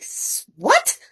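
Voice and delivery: high-pitched, startled retold question; shocked disbelief. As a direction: let the voice leap up in disbelief. Retold shock at being traded.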